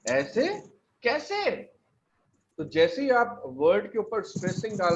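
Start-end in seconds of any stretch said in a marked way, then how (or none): 0.1-1.5 "कैसे?" flicked up high, "ऐसे" lightly up; a quick startled how-so; surprised question. Shown as a demonstration. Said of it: "stressing कर रहे हैं". ("कैसे?" को ऊपर उठाकर चौंककर पूछना)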